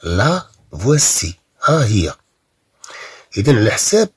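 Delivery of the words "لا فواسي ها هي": لا فواسي said slowly, stressing لا; slow model pronunciation. Shown as a demonstration. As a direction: stretch out لا فواسي for learners. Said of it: "إذن سنقول". (نطق بطيء لـ لا فواسي)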